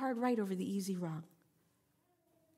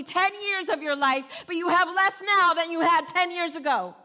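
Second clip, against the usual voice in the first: louder, pressing sermon build; preaching at full force; 5 semitones higher, 6 dB louder than usual. Acted. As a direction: drive each line home to the crowd. Modern paraphrase preached out.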